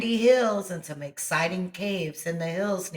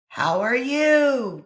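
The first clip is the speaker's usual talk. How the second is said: slow, loud, higher call; 7 semitones higher, 6 dB louder, slower than usual. A narrator's reading. Boy calling to the kid on the other hill, loud. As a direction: call it out across the hills. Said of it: "Johnny called".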